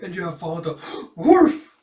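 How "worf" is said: high, loud yelping woof; excited dog bark. Acted. Dog Bond barks.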